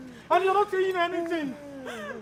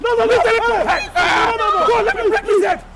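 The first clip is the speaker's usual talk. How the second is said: loud, high angry shouting; heated, raised-pitch yelling; violent outburst; 7 semitones higher, 7 dB louder than usual. Acted. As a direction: shout as if charging into a fight. Threatening violence in a fight.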